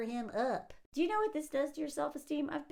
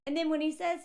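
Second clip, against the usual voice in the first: ordinary talk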